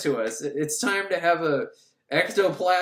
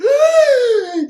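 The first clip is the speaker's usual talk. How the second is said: loud, much higher gasping shriek; huge shrieking gasp; 10 dB louder than usual. Acted. Medium snapping out of trance, loud shrieking gasp. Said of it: "She's like".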